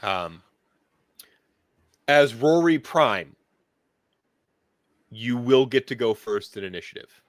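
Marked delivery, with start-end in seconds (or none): 2.1-7.2 grand announcer. Acted. GM announcing Rory Prime.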